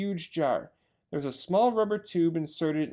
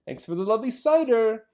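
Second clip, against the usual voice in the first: cheeky call; 6 semitones higher, 5 dB louder than usual. Acted. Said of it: "they called out".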